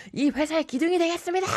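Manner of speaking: high, fast shouted office toast; boisterous company dinner pledge; rowdy toast. Retold or imitated.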